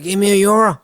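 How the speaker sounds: high, loud Dublin North Side voice; North Side accent. Pretend. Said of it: "Can you say, like".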